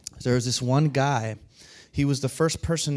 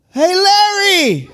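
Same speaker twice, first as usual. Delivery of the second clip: long, high shout; imitated loud greeting; 11 dB louder, much slower than usual. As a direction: holler the name as if he can't hear. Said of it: "we just didn't speak loud enough".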